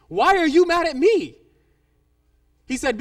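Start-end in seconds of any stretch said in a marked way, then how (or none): 0.1-1.4 loud, high retold protest; indignant disbelief. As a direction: act out her baffled objection. Lady baffled by stranger's anger.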